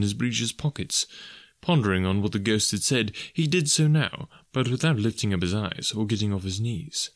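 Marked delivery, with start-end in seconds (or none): none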